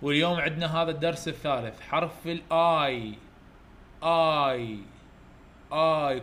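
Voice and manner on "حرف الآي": الآي said slowly, drawn out and clear; slow letter model. Shown as a demonstration. Teacher introducing letter A slowly to pupils. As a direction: stretch out حرف الآي for the kids.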